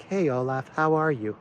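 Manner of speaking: a light, friendly Canadian PM hello; lightly voiced polite prime minister; Justin Trudeau impression. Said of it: "that's my Justin Trudeau impersonation".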